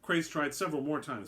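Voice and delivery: ordinary delivery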